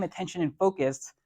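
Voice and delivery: ordinary talk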